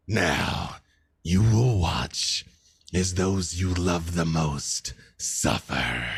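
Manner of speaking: slow, low, gloating threat; a hint of a dark warlord; menacing villain voice. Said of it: "glow around him lights up".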